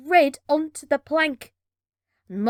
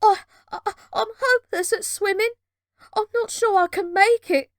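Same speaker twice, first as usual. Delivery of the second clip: panicky; 8 semitones higher, 3 dB louder than usual. Acted. Girl struggling in sea, panicking.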